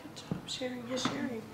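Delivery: soft unvoiced whisper so the class isn't disturbed. Real, not acted. Teacher praising students during partner talk, whispering. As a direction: breathe the praise quietly to one pair.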